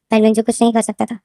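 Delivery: ordinary delivery, nothing marked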